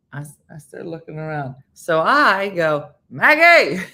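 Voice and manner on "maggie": loud call across the crowd. Retold or imitated. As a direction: call her name out loud. Calling out at the game.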